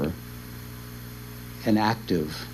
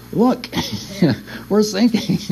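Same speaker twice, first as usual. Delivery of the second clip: high-pitched comic panic with a laugh; mock alarm; 9 semitones higher than usual. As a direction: sound the alarm, half laughing. Retold disciples in sinking boat.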